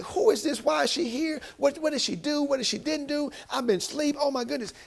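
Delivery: high, frantic rush of questions. Acted. Boaz waking to find a woman at his feet, frantic. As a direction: act out someone startled awake and panicking, voice way up. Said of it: "Can't you see him probably being frantic".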